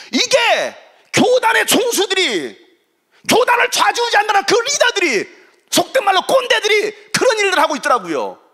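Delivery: high, emphatic sermon outburst; full-force preaching. Real, not acted. Preacher denouncing denomination leaders, high forceful preaching. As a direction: denounce them from the pulpit with force.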